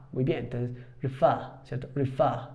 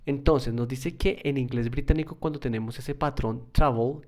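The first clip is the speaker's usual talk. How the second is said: ordinary talk, nothing marked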